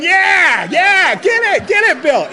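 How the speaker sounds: loud, high hollering encouragement; rowdy cheering on. Acted. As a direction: egg him on at full voice. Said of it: "you can just see 'em going".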